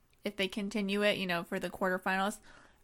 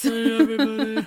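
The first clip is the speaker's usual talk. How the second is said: loud mock voice of a boy whose voice broke; hammed-up teen boy, much louder; cracked teen voice; 11 dB louder than usual. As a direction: belt it out like a teenage boy mid voice-change. Mocking voice-changed teen boy.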